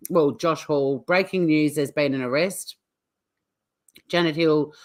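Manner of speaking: usual speaking voice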